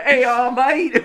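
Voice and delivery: high, slow mock British accent; imitated British accent. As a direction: put on a bad British accent, laughing. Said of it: "like, a British accent".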